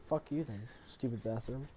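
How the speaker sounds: ordinary talk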